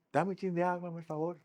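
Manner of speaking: a kind plea for a little water; polite with a smile. Shown as a demonstration. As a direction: ask gently, smiling. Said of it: "Con una sonrisa".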